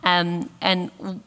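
ordinary talk, nothing marked

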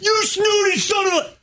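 high-pitched indignant mock shout; mock outrage. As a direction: cry out like an outraged heckler, hammed-up. Heads off imagined indignant listeners.

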